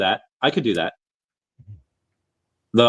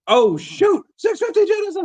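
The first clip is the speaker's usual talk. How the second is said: excited. Real, not acted. Live auction bid calling.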